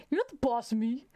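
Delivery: petulant imitation of a refusal; mock childish defiance. Mimicking reactions to pandemic precautions, mock defiant. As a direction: play a child defying orders. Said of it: "we have the basic".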